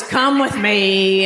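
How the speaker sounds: slow, dragged-out grudging order; mock annoyed. Annoyed clerk leading customer to the camera, mock grudging. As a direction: imitate a weary clerk who resents the task.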